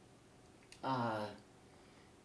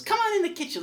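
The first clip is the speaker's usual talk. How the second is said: God welcoming Harry; 8 dB louder, faster than usual. Acted. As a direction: greet him like a jolly host in heaven, pitched way up. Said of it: "And he goes".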